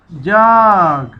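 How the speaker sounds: जाग drawn out very slowly as one joined word; slow blend. Shown as a demonstration. Teacher showing pupils how to join sounds, slowly. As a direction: stretch the vowel of जाग, then close on ग. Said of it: "इकट्ठा पढ़ना है".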